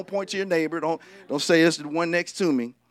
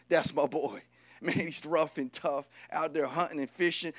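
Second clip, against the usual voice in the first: lightly voiced dad, softer and a little low; understated fatherly pride; hint of a proud father bragging on his son; proud daddy; 3 semitones lower, 7 dB quieter than usual. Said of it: "See, the daddy liked Esau".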